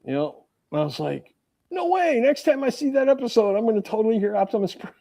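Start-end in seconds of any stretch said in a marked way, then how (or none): 1.7-4.9 high-pitched mock amazement; high squeal easing down, playfully retold; excited retold reaction. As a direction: replay your giddy disbelief, voice shooting up high. Retelling own excited reaction.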